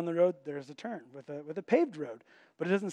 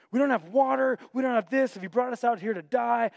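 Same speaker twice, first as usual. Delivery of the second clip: higher and louder, mock grumbling; whiny complaining; 6 semitones higher, 5 dB louder than usual. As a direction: whine like ungrateful wanderers. Israelites complaining to Moses.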